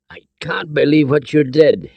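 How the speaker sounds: usual speaking voice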